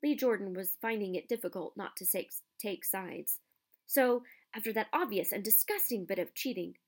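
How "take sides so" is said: ordinary delivery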